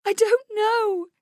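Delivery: high, drawn-out sobbed words; distraught wail, slow and high; sobbing. Acted. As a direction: stretch it into a loud, high sob. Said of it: "she sobs".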